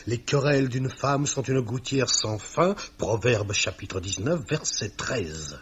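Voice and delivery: ordinary talk, nothing marked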